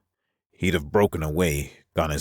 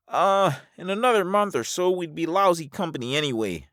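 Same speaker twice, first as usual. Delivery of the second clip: high-voiced Alvar, dismissive. Alvar dismissing staying together, high-pitched voice. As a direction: brush it off in a high register.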